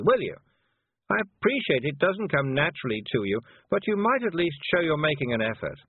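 put-on high, hectoring head-girl voice; imperious, high-pitched scolding; bossy young witch, exasperated. A narrator's reading.

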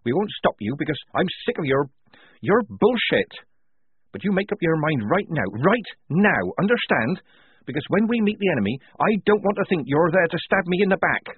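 shouting. A narrator's reading. Polly daring Tonka to desert, shouting angrily. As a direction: lay down the law, loud and high. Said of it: "shouted Polly".

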